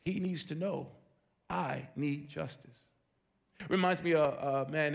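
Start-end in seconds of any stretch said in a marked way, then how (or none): 0.0-2.9 quiet resolve. Acted. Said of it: "She's like".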